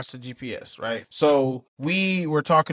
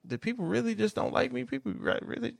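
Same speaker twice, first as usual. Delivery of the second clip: much higher, trailing off quieter; higher and softer, fading away; hurt self-question; 10 semitones higher, 8 dB quieter than usual. Retold or imitated. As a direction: wonder aloud, wounded and fading. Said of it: "it's just like".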